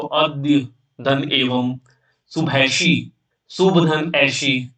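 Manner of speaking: "शुभ धन ऐशी" read slowly, each part apart; slow broken reading. Shown as a demonstration. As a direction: spell it out slowly like a teacher. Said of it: "ऐसे पढ़ने का प्रयास करना".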